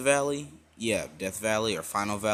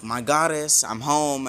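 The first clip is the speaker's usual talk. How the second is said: loud, higher-pitched homecoming call; 5 semitones higher, 6 dB louder than usual. Retold or imitated. Bell arrives home to his goddess, calling out. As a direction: announce yourself through the house.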